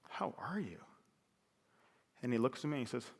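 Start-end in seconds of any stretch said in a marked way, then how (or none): 0.1-0.8 hushed question. Retold or imitated. Son gently asks sick dad.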